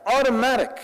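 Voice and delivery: Automatic. said high and punched, falling at the end; one-word emphatic echo; emphatic repeat. Shown as a demonstration. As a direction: hit Automatic. hard and high. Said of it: "That's an automatic upper hand".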